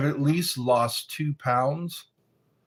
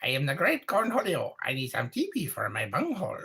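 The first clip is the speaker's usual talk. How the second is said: Cornholio impression; 4 dB quieter, faster than usual. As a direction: rant like a manic cartoon teen. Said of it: "Beavis and Butthead".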